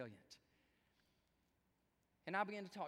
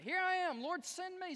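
high, raised-voice offer to God; eager mock volunteering; 13 semitones higher, 7 dB louder than usual. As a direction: call out keenly, playing the obedient servant. Acting out an obedient volunteer.

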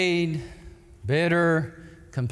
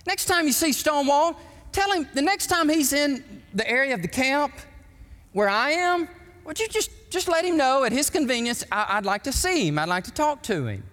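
a courteous general's request, pitched much higher; polite General Lee; 10 semitones higher than usual. Retold or imitated. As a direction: ask gently, like a gracious old general making a humble request. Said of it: "Lee said".

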